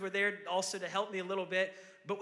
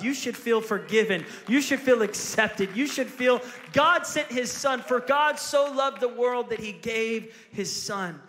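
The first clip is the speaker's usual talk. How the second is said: preaching at full force; 2 semitones higher, 9 dB louder than usual. Acted. Pastor firing up the church with good news, loud preaching. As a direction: build it up and drive each line home to the crowd.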